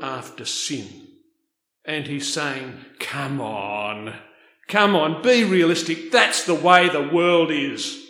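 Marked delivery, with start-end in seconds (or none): 3.0-4.1 low, drawn-out "come on"; weary appeal. Acted. Voices the writer's blunt appeal. 4.7-8.0 loud, emphatic reality check; insistent exhortation. Acted. Voices the writer's blunt appeal.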